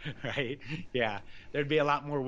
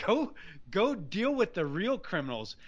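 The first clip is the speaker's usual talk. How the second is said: indignant; 7 semitones higher than usual. Retold or imitated. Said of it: "I was indignant".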